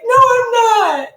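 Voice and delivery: put-on high, loud, slow childish protest; mock a cranky kid denying being tired; whiny kid insisting they're fine; imitating a tired child. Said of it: "they're like".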